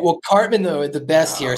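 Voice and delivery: ordinary talk, nothing marked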